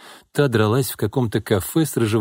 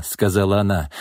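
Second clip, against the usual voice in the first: ordinary delivery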